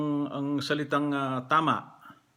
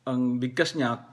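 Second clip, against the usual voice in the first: ordinary talk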